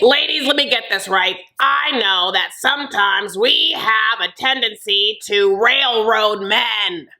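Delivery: stretching each phrase out like a pompous announcement; Lisa impression. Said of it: "Lisa starts to be like".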